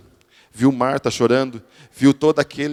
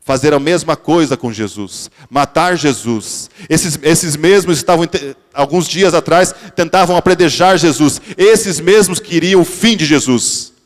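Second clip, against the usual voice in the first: louder, higher preacher's cry; impassioned sermon climax; fervent preaching; 5 semitones higher, 7 dB louder than usual. Acted. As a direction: drive the point home to the congregation. Preacher builds to full force.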